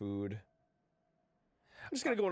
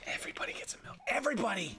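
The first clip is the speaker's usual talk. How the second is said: mock whisper; 9 semitones higher, much faster than usual. Mocking ashamed worker.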